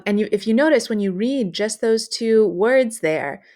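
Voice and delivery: ordinary talk, nothing marked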